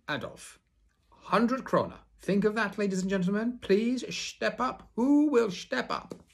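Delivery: high, loud, showy circus announcer; milk the big announcement; booming circus barker, drawing out the strong man's name; grand ringmaster. Acted. Ringmaster challenges the crowd.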